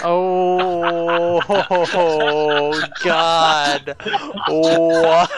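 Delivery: loud, helpless laughing groan; real laughter. Real, not acted. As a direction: crack up in scandalized disbelief. Cracking up at mom joke.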